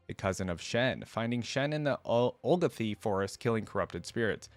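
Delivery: usual speaking voice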